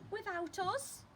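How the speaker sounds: put-on high outraged child; child Luke. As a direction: ask it like a left-out little boy. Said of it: "said Luke".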